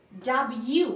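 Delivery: "ডাব্লিউ" as dab-yu, rising at the end; careful teacher's dab-yu for "ডাব্লিউ"; correct W pronunciation. Shown as a demonstration. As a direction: model "ডাব্লিউ" without the L. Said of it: "এটার সঠিক উচ্চারণ হচ্ছে".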